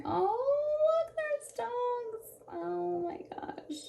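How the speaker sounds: delighted surprise. Real, not acted. Spots the dogs on camera.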